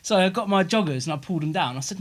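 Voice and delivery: ordinary talk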